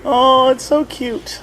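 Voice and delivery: high, slow gushing coo; teasing, high-pitched adoration; mock cooing. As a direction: coo as if at a small child. Teasing co-host's childish age.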